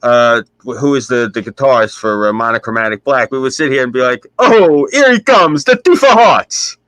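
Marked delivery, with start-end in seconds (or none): 4.4-6.8 high, slow, heavy Sicilian Brooklyn accent, mocking; mock the boss with a thick Sicilian Brooklyn accent; teasing a Sicilian-from-Brooklyn boss in his own heavy accent. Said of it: "his accent was so heavy".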